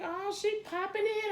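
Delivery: mock high voice, sizing herself up; high inner voice. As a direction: pitch it up like a self-mocking thought. Inner voice in class.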